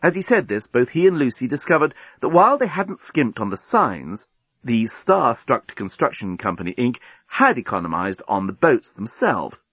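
usual speaking voice

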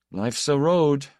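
mildly pompous, empty declaration; fatuous. A narrator's reading. Man hearing his own words replayed, fatuous. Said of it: "he heard his voice saying fatuously".

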